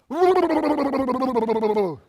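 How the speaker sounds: long, loud falling lip trill; lip-trill demo. Shown as a demonstration. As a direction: blubber your lips as if amusing a toddler. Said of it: "just go".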